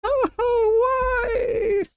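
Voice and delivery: high whimpering cry; mock crying. Real, not acted. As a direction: sob out a hopeless why. Crying over death.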